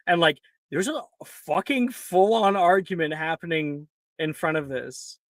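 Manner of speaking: ordinary delivery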